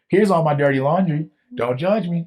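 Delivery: high-pitched, hammed-up plea; mock confession. Sinner showing his shame to others, mock confession. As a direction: blurt it out as a mock defensive confession.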